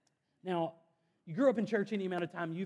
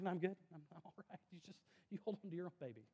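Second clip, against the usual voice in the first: softer, quick, laughing brush-off; awkward refusal; 2 semitones higher, 9 dB quieter, much faster than usual. Retold or imitated. Dodging a held-out baby, quick soft laughing refusal. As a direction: back away politely, laughing. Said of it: "I'm like".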